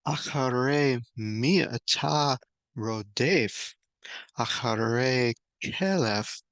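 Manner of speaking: slow, careful recitation of the Hebrew; Hebrew verse reading. Shown as a demonstration. Preacher reading the Hebrew verse aloud, slow and deliberate. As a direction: read the verse slowly, word by word. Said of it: "I'm sure it came out much more fluid".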